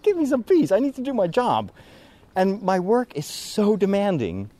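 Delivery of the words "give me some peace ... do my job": high, pleading exasperation; exasperated plea. Retold or imitated. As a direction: beg for some peace. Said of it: "starting to get fed up".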